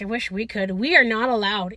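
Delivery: ordinary talk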